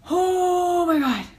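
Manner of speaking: high, long-drawn "oh" of dread; horrified exclamation. Retold or imitated. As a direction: draw it out high in mock horror. Reacting to tick under skin.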